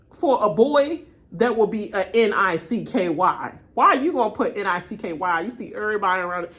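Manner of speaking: ordinary talk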